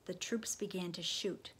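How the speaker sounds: plain reading voice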